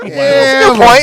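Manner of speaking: mock agreement. Teasing Shelly, repeated line.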